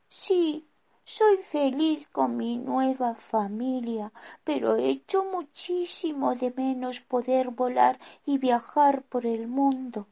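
a little penguin longing to fly; high bird voice. Acted. Carlota's voice in story.

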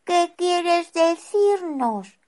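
put-on a small boy missing his family; high child voice. Rufino asks dizzily.

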